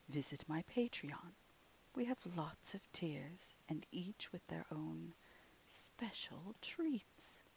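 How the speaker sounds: hushed, slow, soft sign-off; soft whispered podcast outro. Real, not acted. The ghostess thanks her listeners in a soft hush.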